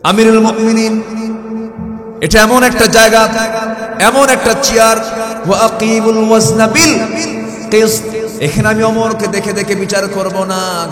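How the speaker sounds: high, loud firm ruling; firm judge. Acted. Judge addressing the caliph from the bench, firm loud voice. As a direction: proclaim justice without fear. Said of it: "চেয়ারে বসে বলতেছেন".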